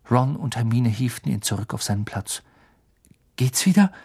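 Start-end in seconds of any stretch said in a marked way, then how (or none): 3.4-3.9 worried, pitched up a little; nervous. A narrator's reading. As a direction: ask anxiously after a friend. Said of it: "fragte Ron nervös".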